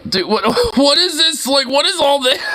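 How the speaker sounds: high, giggling incredulity; laughing disbelief. Real, not acted. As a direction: crack up while asking, pitch climbing. Laughing at what he sees.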